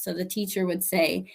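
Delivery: normal talking voice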